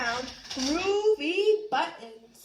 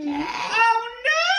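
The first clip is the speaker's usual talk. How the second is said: plain reading voice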